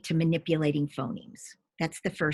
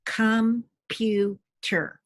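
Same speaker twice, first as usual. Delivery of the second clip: slow, syllable by syllable; syllables pulled apart; 4 semitones higher, 3 dB louder, much slower than usual. Shown as a demonstration. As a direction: segment the word into its syllables, slowly. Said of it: "What are the syllables in computer?".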